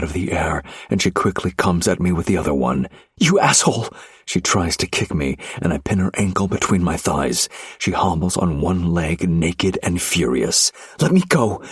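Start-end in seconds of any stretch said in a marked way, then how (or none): 3.2-3.9 high, loud angry yell; furious shout. Acted. Wife yelling at husband, furious shout. Viviana swings at him furiously. 11.0-11.6 high, loud demand to be freed; furious shout. Acted. Wife pinned by husband, shouting to be freed. Viviana struggles against his grip.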